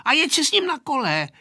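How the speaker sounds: high-pitched exclamation; crying out. A narrator's reading. Aneška sends the dog off before shutting the door, crying out. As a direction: pitch it high, like a cross girl calling out. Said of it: "vykřikla Aneška".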